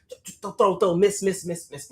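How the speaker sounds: ordinary delivery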